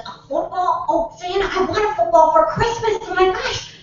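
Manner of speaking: put-on high, excited little-boy voice; Billy. Grandson gets his Christmas wish from Santa, high excited child. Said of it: "And Billy says".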